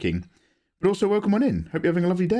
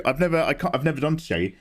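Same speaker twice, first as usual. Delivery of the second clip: usual speaking voice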